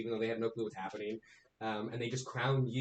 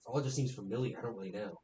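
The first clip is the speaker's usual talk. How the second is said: somber; 3 semitones lower, 5 dB quieter than usual. Acted. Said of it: "He gets all somber".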